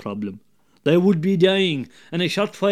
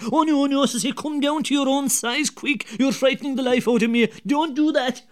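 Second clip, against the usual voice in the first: higher, fast pretend panic; mock fright; 9 semitones higher than usual. Larry pretending to the devil he's frightened, mock panic. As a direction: fake being scared out of your wits. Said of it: "Larry now pretended to be frightened".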